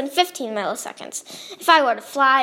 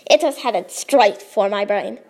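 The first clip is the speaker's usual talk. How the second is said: usual speaking voice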